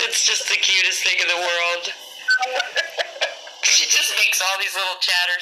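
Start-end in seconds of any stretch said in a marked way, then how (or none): 2.0-3.6 soft, high airy giggle; laughing. Real, not acted. Charmed by how the little girl talks, soft laughing. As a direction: giggle quietly with delight. Finds child's talk cute.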